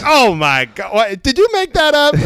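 high-pitched incredulous outburst; mock astonishment. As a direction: imitate someone floored by a joke, pitch climbing. Retold lady's amazed reaction.

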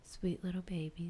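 whispering. Real, not acted. Cooing over sweet friends.

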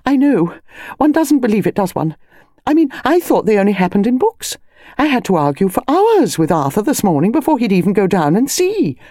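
high, gushing, agitated phone voice; agitated Mrs Bantry, excitable. A narrator's reading. Friend describing her argument over the body, agitated and high. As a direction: pour it out to a friend, pitch swooping high.

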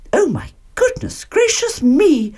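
high-pitched astonished cry; shocked Mother Bear. A narrator's reading. Mother Bear sees the sleeping girl, high shocked cry. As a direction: exclaim it high, aghast. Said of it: "cried Mother Bear".

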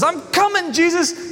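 very high excited call; eager Peter. Acted. Peter calling to Jesus from the boat, high and eager. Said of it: "he says".

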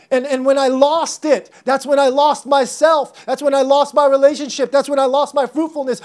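high, loud, driving repetition; impassioned lament. Acted. Preacher as David telling what he lost, impassioned lament. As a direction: pour out each loss fervently.

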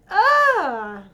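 high, loud groan of frustration; drawn-out frustrated "ah"; exasperated groan. Real, not acted. Exasperated at praise for cruelty.

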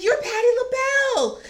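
gushing at the star she just insulted; starstruck surprise. Retold or imitated.

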